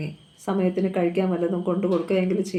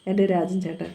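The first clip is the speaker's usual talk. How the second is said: usual speaking voice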